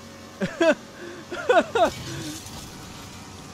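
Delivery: squealing laughter; high cackling laugh. Real, not acted. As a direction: burst into high giggles. Laughing at the crash.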